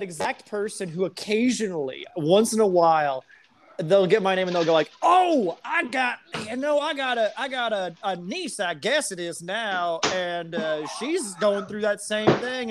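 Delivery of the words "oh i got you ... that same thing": higher, awkward customer voice; imitating a customer. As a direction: play the well-meaning customer fumbling for words. Imitating customer talking about niece.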